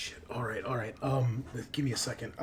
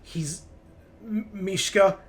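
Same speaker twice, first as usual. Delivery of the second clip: halting, stuttering voice of a failing android, pitched up; high, broken-up robot speech with long gaps; glitching android; 6 semitones higher, 6 dB louder than usual. Acted. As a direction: stutter and stall like a machine shutting down. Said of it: "then he starts to glitch out".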